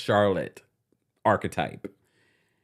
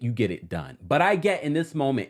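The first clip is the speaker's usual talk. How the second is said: ordinary delivery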